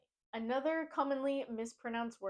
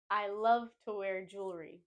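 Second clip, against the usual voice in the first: ordinary talk, nothing marked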